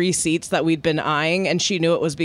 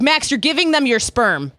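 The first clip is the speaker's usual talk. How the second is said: imitating Barb's yell; 6 semitones higher, 4 dB louder than usual. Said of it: "and was like".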